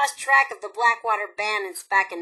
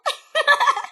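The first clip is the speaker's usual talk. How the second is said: high gleeful laugh. Acted. Gloating after mission accomplished.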